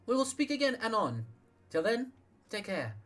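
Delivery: a man lifting his voice for a woman leader; female leader voice. Acted.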